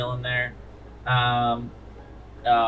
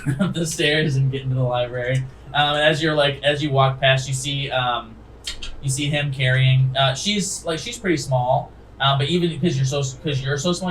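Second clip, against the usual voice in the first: ordinary delivery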